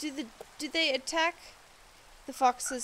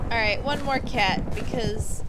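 louder, energetic; loud announcement; 3 semitones lower, 7 dB louder than usual. Real, not acted. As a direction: call out the next step.

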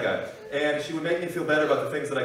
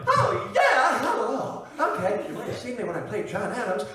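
high-pitched, excitable actor impression; Paul Giamatti; 7 semitones higher than usual. Actor greeting fans about his roles, high put-on voice. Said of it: "Paul Giamatti".

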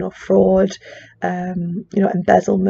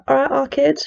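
higher-pitched, jokey Manchester accent; 6 semitones higher than usual. Shown as a demonstration. Student demonstrating city accents on a whim, Manchester accent. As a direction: toss off a Manchester catchphrase. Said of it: "you know, that kind of accent".

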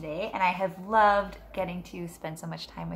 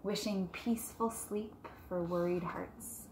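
put-on reading to children; 6 dB quieter than usual. Teacher reading Moon Wishes to her class, gentle storybook voice. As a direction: read it softly to a class of kids. Reads picture book aloud.

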